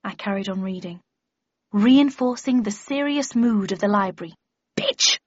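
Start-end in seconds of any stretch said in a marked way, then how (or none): 1.7-4.3 louder, confident recitation; expressive reading aloud. A narrator's reading. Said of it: "expressive and expansive". 4.8-5.2 short sharp character cry; cried insult. A narrator's reading. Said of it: "I heard Shithouse cry".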